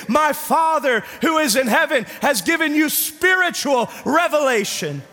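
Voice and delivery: high-pitched, impassioned preaching; full-force preaching. Real, not acted. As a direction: proclaim it to the whole church.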